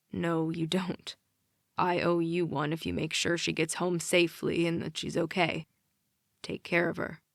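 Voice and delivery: quiet and grave, meaning it; serious. Acted. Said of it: "Nick sounds serious".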